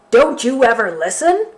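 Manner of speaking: loud bellowing bear. Acted. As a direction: bellow it in frustration. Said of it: "bellowed the bear".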